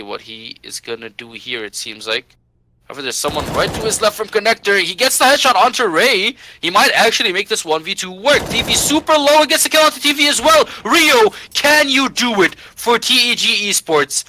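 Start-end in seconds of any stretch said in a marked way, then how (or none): 2.8-14.2 esports caster. Real, not acted. Clutch round play-by-play hype.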